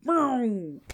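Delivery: drawn-out, punchy boom; imitated vroom-away boom; car zooming off. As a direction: whoosh the word out like a speeding car. Imitates car speeding out of sight.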